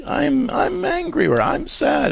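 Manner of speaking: imitating a small child. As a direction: pipe up like a four-year-old naming her feeling. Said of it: "Have her say it when she says".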